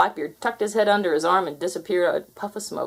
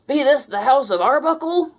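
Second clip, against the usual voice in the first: loud, higher, snarling pirate; growling pirate; 8 semitones higher, 5 dB louder than usual. Acted. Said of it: "growled Blackbeard".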